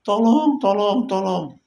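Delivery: high mock cry for help; imitating a desperate plea, pitched high. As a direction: plead like a man being beaten. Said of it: "Yang Israel sambat-sambat".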